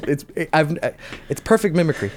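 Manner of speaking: ordinary delivery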